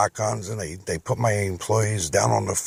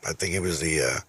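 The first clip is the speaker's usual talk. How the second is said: ordinary talk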